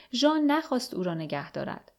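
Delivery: ordinary delivery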